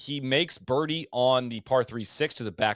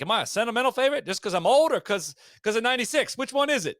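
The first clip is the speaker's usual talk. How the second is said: hammed-up Australian, high-pitched and loud; bad Australian accent; 11 semitones higher, 4 dB louder, faster than usual. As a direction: do your worst Australian Greg Norman impression. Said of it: "that's my worst Australian accent ever".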